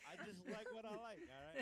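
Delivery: defensive outburst. Real, not acted. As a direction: protest with indignation. Defensive retort.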